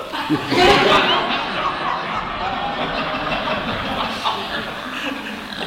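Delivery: long airy laugh with no voice; breathy laugh. Real, not acted. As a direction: wheeze with laughter. Laughing at his own story.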